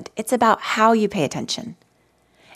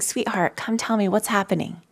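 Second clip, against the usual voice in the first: softer, tender invitation; loving parent; 2 semitones higher, 5 dB quieter than usual. Retold or imitated. Best parent to a child, soft and tender. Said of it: "like the best parent you could imagine saying".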